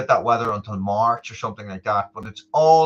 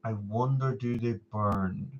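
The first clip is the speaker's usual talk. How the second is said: quiet musing; 2 semitones lower, 7 dB quieter, slower than usual. Real, not acted. Slow repeated musing.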